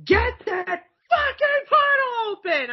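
high, slow yell through laughter, imitating Millie; laughing shout. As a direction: bellow the order while cracking up. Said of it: "and Millie's like".

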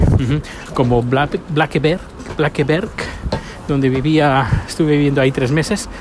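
ordinary talk

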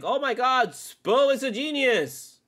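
high, slow sarcastic gushing; mock praise. Fan mocking people praising the coach, sarcastic gushing. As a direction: drag it out in fake awe. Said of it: "and it's like".

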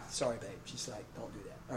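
low, very soft apology; hushed, low-pitched aside to his wife; quiet aside. Real, not acted. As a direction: drop to a murmured aside.

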